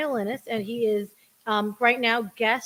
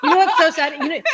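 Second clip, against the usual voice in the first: 1 laughing; 5 semitones higher, 7 dB louder, faster than usual. Real, not acted. Laughing aside.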